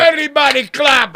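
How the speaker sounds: loud high laughing call to clap; hype man shout. Pretend. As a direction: pump up the crowd, laughing.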